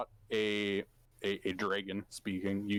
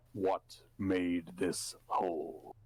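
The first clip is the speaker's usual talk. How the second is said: deep dragon voice; 3 semitones lower, slower than usual. Acted. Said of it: "you hear a deep voice".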